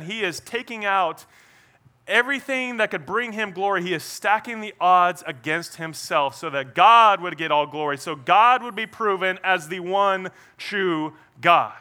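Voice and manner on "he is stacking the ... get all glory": usual speaking voice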